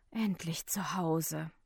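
slow, hushed, breathy; relieved thought. A narrator's reading. Tired man gets home, thinks it softly with relief. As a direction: sigh it softly to oneself. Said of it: "denke ich".

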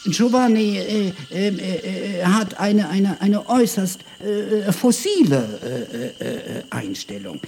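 high, hesitant professor voice full of ums; a pompous scholar stumbling over every word; stammering professor. Acted. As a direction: stumble through it like a fussy old academic.

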